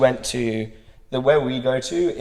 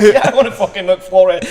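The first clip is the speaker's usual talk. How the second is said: laughing through the reply, pitched up and a bit louder; 8 semitones higher, 6 dB louder than usual. Retold or imitated. Answering the Scottish guy's offer, laughing. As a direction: answer back with giggles in the voice. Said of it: "And I was like".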